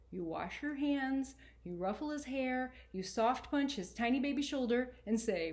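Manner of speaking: put-on lively first-person reading of a boy's journal; story reading. A narrator's reading. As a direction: read it like a kid talking straight to you. Author reads her novel aloud.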